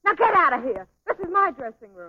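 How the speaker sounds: loud, high, indignant outburst; furious order. Acted. Dancer throwing partner out of her dressing room, angry shout.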